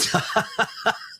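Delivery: quick breathy laugh at his own joke; short laugh. Real, not acted. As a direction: let out a short unvoiced chuckle.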